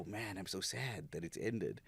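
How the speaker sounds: mock sad sigh. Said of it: "and you often go".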